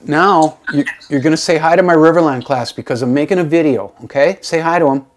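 loud call to someone in another room. Real, not acted. Teacher calling his wife over to greet the class, loud. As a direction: raise your voice so she hears you.

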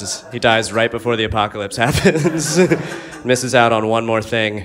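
ordinary talk, nothing marked

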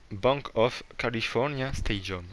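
slow, carefully spelled-out English, "Bank of California Stadium" said word by word; careful English accent. Shown as a demonstration. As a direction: articulate "Bank of California Stadium" slowly in English for French viewers. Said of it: "je vais l'écrire parce que vous comprenez pas". (accent anglais appliqué, lent et détaché)

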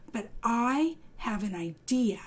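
hushed, breathless frog whisper; secretive whisper. Acted. Frog shares a secret plan.

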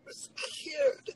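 high, breaking cry; crying quote. Retold or imitated. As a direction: cry out the daughter's words. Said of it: "She said".

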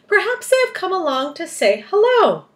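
high, loud storybook grasshopper. A narrator's reading. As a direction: chirp it hopefully. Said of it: "said the old green grasshopper".

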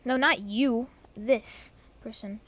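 ordinary talk

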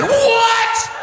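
high squeaky shocked what. Retold or imitated. Audience gasps, shocked high what. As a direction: crowd reacting in disbelief. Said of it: "and everybody said".

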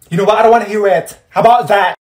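high, loud, final; fed-up friend yelling; angry outburst. Acted. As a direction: end the conversation hard. Friend loses patience.

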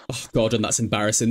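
low, disappointed sigh of Gordon; fond reproach. Real, not acted. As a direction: let Gordon down gently, tutting. Disappointed at Sim.

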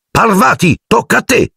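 high, urgent teacher's shout; called-out yell. A narrator's reading. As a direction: yell the name and order high. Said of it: "il professor Lupin urlò". (urlo acuto da professore)

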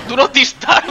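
high, loud read breaking into laughter; laughing. Real, not acted. Player reading a door sign, laughing. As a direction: crack up while reading it out. Reads sign while cracking up.

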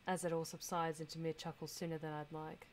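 normal talking voice

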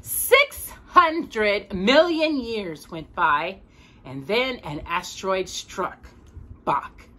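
storybook voice for a little listener; gentle read-aloud narration; reading to a child. A narrator's reading. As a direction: read it like a bedtime picture book. Reads picture book to child.

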